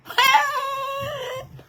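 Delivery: high piercing cackle bursting out; shrill cackling laugh. Real, not acted. Vlogger cackling wildly at her own warning. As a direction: cackle high and wild at the punchline. Bursts out after curse line.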